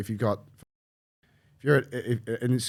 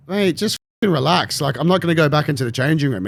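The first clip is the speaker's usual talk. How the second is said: a higher, louder retold snap at the official; mock-annoyed, louder, telling him to back off; irritated retort; 6 semitones higher, 6 dB louder than usual. Retelling telling the official to relax, louder mock-irritated.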